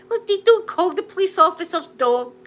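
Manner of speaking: high, loud telling-off; mock scolding. Acted scolding of the suspect.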